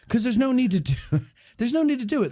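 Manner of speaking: laughing. Real, not acted. Laughing while explaining.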